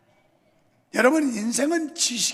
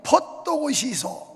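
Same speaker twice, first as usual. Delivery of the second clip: quick, loud Gyeongsang call to hurry; Gyeongsang dialect; 4 semitones higher, 4 dB louder, much slower than usual. Retold or imitated. Preacher mimics a Gyeongsang speaker urging someone, brisk Gyeongsang accent. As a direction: hurry them up, Gyeongsang style. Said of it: "경상".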